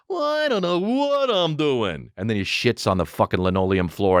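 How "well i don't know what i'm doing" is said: high, slow, drawn-out dopey voice; hammed-up clueless dad; dumb commercial dad. Dad bumbles in a commercial, mock idiot voice. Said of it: "the dad's like".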